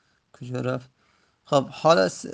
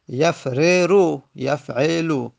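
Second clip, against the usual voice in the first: drawn out slowly, each syllable clear; slow demonstration; much slower than usual. Shown as a demonstration. Teacher sounding out the unmerged form slowly.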